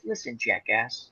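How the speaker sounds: low, slow, quiet start, then deliberate; understated mock menace; talking horse threat. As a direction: imitate a horse calmly warning its rider. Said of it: "again, he says".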